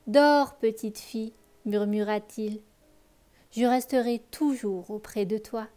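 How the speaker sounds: put-on lively read-aloud voice for children; expressive bedtime story reading; story narration. A narrator's reading. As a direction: tell the tale warmly to a child listener.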